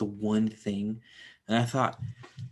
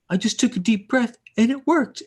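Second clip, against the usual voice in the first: very high, louder mock-excited quote; acted movie character; 13 semitones higher, 5 dB louder than usual. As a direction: pitch it way up, playing the character. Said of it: "He's like".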